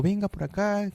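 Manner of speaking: a light imitation of the kind Ecuadorian saleswoman; kind Ecuadorian lady. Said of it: "pero siempre era como".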